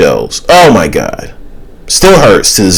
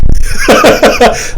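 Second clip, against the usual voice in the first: high, giggling burst of laughter; amused laugh. Real, not acted. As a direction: crack up at the idea. Amused by his imagined British scene.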